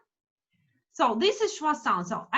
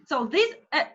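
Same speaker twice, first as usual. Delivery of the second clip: usual speaking voice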